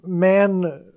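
ordinary delivery

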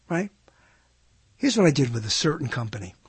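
usual speaking voice

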